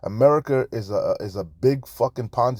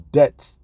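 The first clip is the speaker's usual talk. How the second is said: Debts punched out loud as its own sentence; emphatic debts; 3 semitones higher, 7 dB louder than usual. Real, not acted. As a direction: land the word Debts hard and loud. Emphatic one-word punchline in rant.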